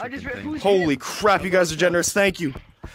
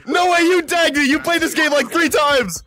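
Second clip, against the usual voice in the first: shocked disbelief; 7 semitones higher than usual. Real, not acted. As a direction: shout out in disbelief. Tagged in game.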